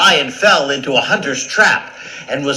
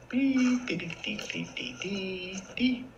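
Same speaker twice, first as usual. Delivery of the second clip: high, soft dee-dee trill; light little scurrying noises in a high voice; tiny high mouse pitter-patter; mouse scampering; 9 semitones higher, 10 dB quieter than usual. Acted. Mouse scampering over lion.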